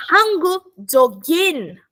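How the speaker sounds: Hangu Dogin drawn out very slowly, syllable by syllable; teacher spelling out Hangu Dogin aloud; slow pronunciation demo. Shown as a demonstration. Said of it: "é".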